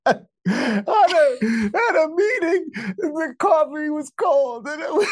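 hammed-up crybaby wail about cold coffee; mock wailing. Joins the fake crying bit.

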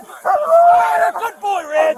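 high, drawn-out excited dog praise; squeaky praising a dog. Real, not acted. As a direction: gush over the dog, slow and high. Handler praising dog after arrest.

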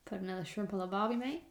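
put-on soft, half-hearted Australian accent; attempted Australian accent. Co-host taking a turn, soft half-hearted Australian accent. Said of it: "I'm not very good at the Aussie accent".

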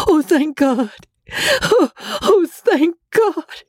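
high, gasping, overjoyed relief; faint with joy. A narrator's reading. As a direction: gasp thanks, overcome with joy. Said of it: "faint with the fullness of her joy".

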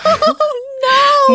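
shrieking with laughter; high laughing yelp of fright; laughing scream. Real, not acted. Frightened by ghost story.

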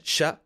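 "Chat" said the French way, as "sha", with no final t; French pronunciation. Shown as a demonstration. Host showing listeners how French say Chat, "sha". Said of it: "say it with, uh, a French accent".

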